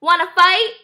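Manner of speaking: high, loud challenge; scrappy storybook bug; grouchy ladybug. A narrator's reading. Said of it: "said the grouchy ladybug".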